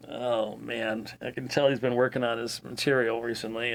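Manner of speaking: imagined audience aside. Pretend. Host voices viewers reacting to dense material, quiet mock aside. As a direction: drop into a low listener's aside.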